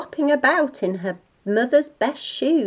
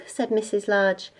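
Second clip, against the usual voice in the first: ordinary delivery, nothing marked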